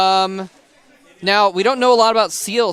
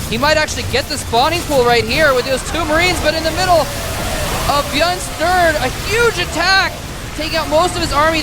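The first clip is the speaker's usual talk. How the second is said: excited; 8 semitones higher than usual. Real, not acted. Big attack in the game.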